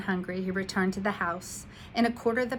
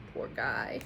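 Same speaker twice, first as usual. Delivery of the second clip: sympathetic aside; 9 semitones lower, 4 dB quieter than usual. Real, not acted. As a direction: say it with sorry sympathy. Reader's aside.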